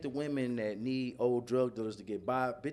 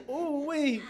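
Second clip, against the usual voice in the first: laughing ooh-wee; 13 semitones higher, 4 dB louder than usual. Real, not acted. Reacting with a laugh.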